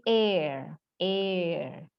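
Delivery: slow, drawn-out "air", no h; silent-h pronunciation. Shown as a demonstration. Teacher modelling the silent letter, slow correct pronunciation. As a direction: begin "air" on the vowel and hold it. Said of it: "Huruf H di depannya silent".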